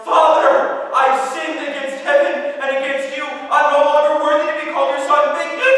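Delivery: high full-lunged cry of repentance; desperate shout. Acted. Son face down in the mud crying out to his father, shouting. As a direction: yell it over your pounding heart. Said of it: "calls out of the top of his lungs".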